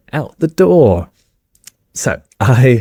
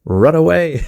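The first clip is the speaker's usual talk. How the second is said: urgent warning; 8 semitones higher than usual. Retold or imitated. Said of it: "You're like".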